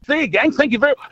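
bright, loud goodbye; cheery sign-off. Real, not acted. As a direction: call out a big cheerful farewell.

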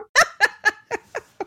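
high, helpless chuckle; real laughter. Real, not acted. Woman laughing at her own sushi blunder, high chuckle.

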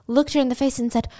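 normal talking voice

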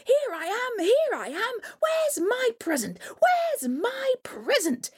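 put-on very high eager child voice; excited little boy. Butterball jumps out for his present, very high and eager. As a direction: bounce with excitement.

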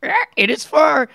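parrot voice. Shown as a demonstration. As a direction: squawk it like a parrot. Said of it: "I like the parrot voice one".

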